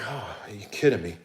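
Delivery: low, soft, deflated disbelief. Retold or imitated. Muttering to the crew after their excuse, quiet disbelief. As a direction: let it drop, quietly fed up.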